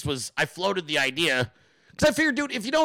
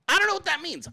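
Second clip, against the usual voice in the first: much higher, baffled repeat; mock baffled; 13 semitones higher than usual. Shown as a demonstration. Repeating the phrase in a high baffled voice. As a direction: echo it in a high puzzled voice.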